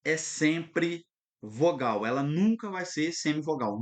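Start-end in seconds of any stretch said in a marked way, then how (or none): 0.0-2.0 slow dictation. Shown as a demonstration. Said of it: "anota aí pra você".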